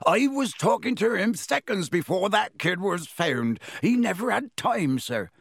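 high, agitated pleading; ranting Hagrid. A narrator's reading. Hagrid pleading with Dumbledore, agitated rant. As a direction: rant on, no room for interruption. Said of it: "Hagrid went ranting on".

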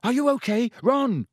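high anxious calls; shouting. Acted. Harry calling to trapped Ron, shouting. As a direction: shout through the rock wall. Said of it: "he shouted".

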